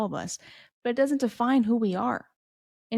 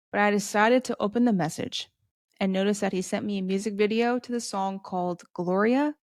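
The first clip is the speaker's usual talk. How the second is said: ordinary talk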